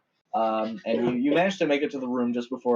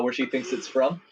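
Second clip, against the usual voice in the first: ordinary delivery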